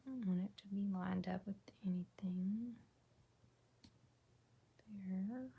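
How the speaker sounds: soft whisper while concentrating; hushed, focused whisper; whispering to herself. Real, not acted. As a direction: murmur under your breath as you work. Concentrating while placing stamps.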